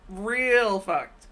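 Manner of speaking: ordinary delivery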